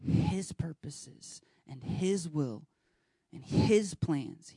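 His hit on each phrase, slow and deliberate; stress on His. Shown as a demonstration. Preacher stressing each His to the church. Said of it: "slow emphatic preaching".

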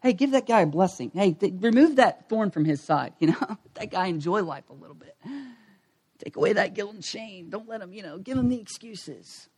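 hushed sly indulgence; mock indulgent enemy. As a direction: play the tempter letting him off easy. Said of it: "he's like".

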